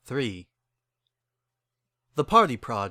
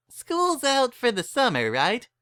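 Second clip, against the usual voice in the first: much higher, slow teasing question; higher teasing mare; 13 semitones higher, slower than usual. Acted. Carrot Top hints at Berry Punch, teasing. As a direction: tease Berry Punch knowingly.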